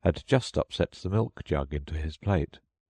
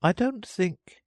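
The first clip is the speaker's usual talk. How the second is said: confused; slower than usual. A narrator's reading. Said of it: "very much confused".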